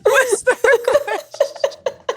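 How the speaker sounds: laugh-cry it out, slowing at the end; laughing through tears. Real, not acted. Host laughing through tears at her own emotion. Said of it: "I did not expect to say that and cry".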